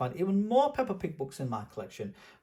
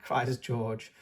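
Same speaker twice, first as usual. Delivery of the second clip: ordinary delivery, nothing marked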